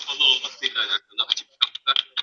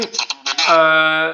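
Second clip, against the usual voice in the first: through a wall; 4 semitones higher, 5 dB louder, much slower than usual. Real, not acted. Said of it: "patlamaya başladı abi".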